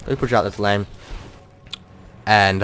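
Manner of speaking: ordinary talk, nothing marked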